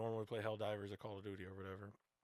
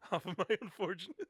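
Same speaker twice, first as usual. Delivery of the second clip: laughing; 7 semitones higher, 5 dB louder than usual. Real, not acted. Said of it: "Yeah, I am jerking off".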